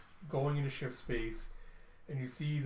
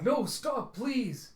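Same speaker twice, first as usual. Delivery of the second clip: high, loud frantic plea; a robot boy pleading with his friend; desperate pleading; 10 semitones higher, 5 dB louder, much slower than usual. Acted. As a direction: beg them to stop. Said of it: "And Tim's like".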